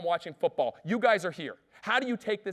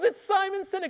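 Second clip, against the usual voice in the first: much higher put-on voice, louder; Simon Sinek impression; 6 dB louder than usual. Mock Simon Sinek voice, high and insistent. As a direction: author patiently repeating his name to the disbelieving caller. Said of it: "He goes".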